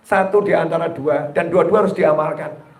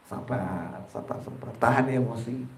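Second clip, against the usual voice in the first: hushed whisper, talking himself down; whispered self-calming; 7 semitones lower, 13 dB quieter than usual. Pretend. Husband soothing himself while his wife scolds, whispered. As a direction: breathe it out under your breath, barely voiced.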